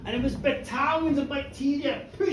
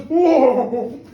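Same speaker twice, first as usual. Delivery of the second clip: alarmed whoa; 9 dB louder than usual. Acted. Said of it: "the doctor goes".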